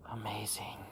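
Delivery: whispered in wonder; awed whisper. A narrator's reading. Narrator savouring the worm's first taste, awed whisper. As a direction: breathe it like a secret delight.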